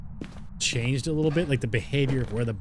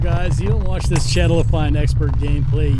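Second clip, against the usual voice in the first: loud, upbeat address to viewers; 10 dB louder than usual. Real, not acted. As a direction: say it loudly to the audience.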